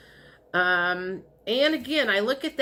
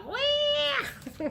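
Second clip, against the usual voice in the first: very high, held mock wail; cartoon baby cry. Imitating a cartoon baby crying at the universe, mock wail. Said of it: "a cartoon baby crying".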